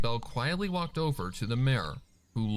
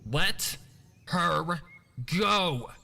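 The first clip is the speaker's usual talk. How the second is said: shouting; 6 semitones higher, much slower than usual. A narrator's reading. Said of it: "she shouted".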